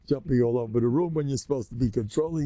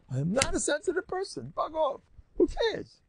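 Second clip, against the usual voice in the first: "I am not a sensitive person" declared much higher and bolder, "Bug off" and "Who cares" tossed off high; official declaration. Shown as a demonstration. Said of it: "Let's make that official".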